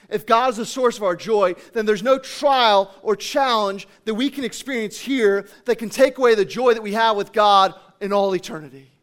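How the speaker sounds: loud, driving sermon build; forceful, emphatic preacher delivery; impassioned preaching. Real, not acted.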